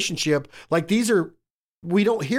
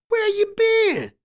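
much higher voiced friend, teasing disbelief; imitating the woman. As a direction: call out like you thought he was gone.

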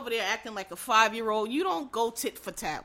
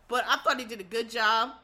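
ordinary talk, nothing marked

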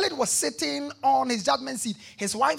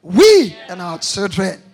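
shout; 3 semitones lower, 12 dB louder, slower than usual. Acted. Preacher calling out scripture.

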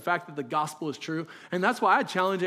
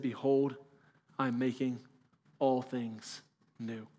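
hushed proclamation; 6 semitones lower, 5 dB quieter, slower than usual. Acted. Quoting God's throne words.

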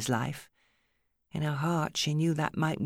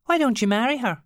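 high, louder Irish challenge; 11 semitones higher, 8 dB louder, faster than usual. A narrator's reading. Alice challenging Steve to marry, raised Irish lilt. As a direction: put it to him straight, Irish.

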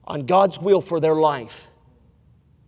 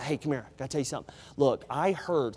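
much softer, lower conspiratorial murmur; secretive gossip; 6 semitones lower, 10 dB quieter, faster than usual. Retold or imitated. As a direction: lean in and hush like passing a secret. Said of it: "Somebody starts a sentence like that".

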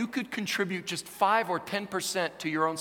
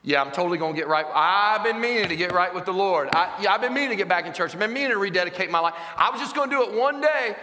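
loud, hurried excuses climbing higher; mock indignant self-justifying, building louder; protesting procrastinator; 4 semitones higher, 7 dB louder than usual. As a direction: pile up excuses, ending in a high protest.

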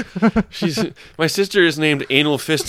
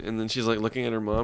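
ordinary talk, nothing marked

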